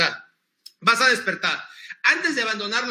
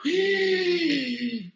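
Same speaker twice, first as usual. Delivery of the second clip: demo gasp; 9 semitones higher than usual. Shown as a demonstration. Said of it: "cómo le haces para interrumpir la emoción?".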